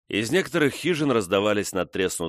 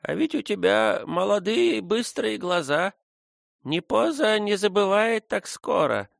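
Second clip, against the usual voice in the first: put-on old woman mumbling; 13 semitones higher than usual. A narrator's reading. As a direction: mumble it like a toothless old nurse. Old Neposa speaks to Frona.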